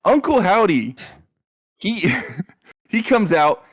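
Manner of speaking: ordinary talk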